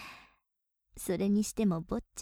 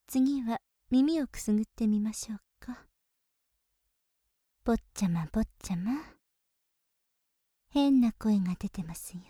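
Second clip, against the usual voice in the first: ear whisper. Acted. Maid whispering into her master's ear, teasing. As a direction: murmur close and mischievous.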